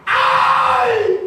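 sudden shrieking cry of pain; high pained scream. Acted. The Tiger screams as the bat hits him, a high pained scream. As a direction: scream like you just got hit with a bat. The Tiger cries out when beaten.

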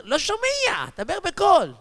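high-pitched mimicked deaf elder demanding volume; acted high, insistent cry; hard-of-hearing old man. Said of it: "עושה לי".

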